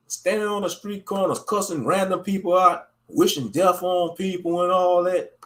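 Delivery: ordinary delivery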